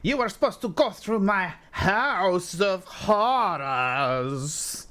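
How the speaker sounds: high, slow French candelabra voice; French accent. Acted. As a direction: play an outraged French candlestick. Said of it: "you're just gonna see this candelabra".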